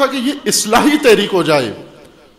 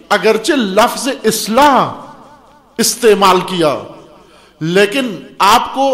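building, ever more forceful sermon; fiery preaching; 2 semitones higher, 5 dB louder than usual. Acted. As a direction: climb to a preaching peak.

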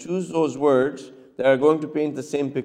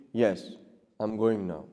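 calm quiet reply; 6 semitones lower, 8 dB quieter than usual. Shown as a demonstration. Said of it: "It's a different pitch".

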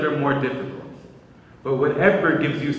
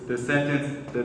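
ordinary talk

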